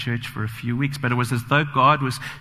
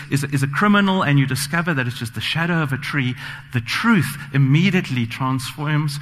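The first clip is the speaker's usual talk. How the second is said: ordinary delivery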